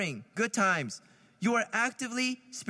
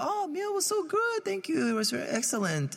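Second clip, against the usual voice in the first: polite guest; 8 semitones higher than usual. Retold or imitated. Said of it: "the guest".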